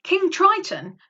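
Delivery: usual speaking voice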